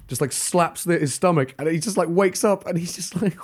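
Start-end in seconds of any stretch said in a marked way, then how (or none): none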